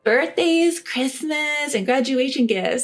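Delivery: high, pushy voice, talking down; condescending sister-in-law demanding gifts, high-pitched; entitled sister-in-law. Acted. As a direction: preach common sense at her like she's slow.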